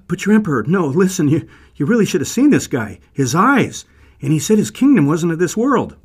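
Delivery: panicked pleading. Acted. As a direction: babble excuses to an angry boss.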